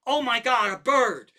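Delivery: high, loud alarmed cry; really loud. Retold or imitated. Wife spotting a bird, a loud, high alarmed cry. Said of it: "doesn't scream, but she says it really loud".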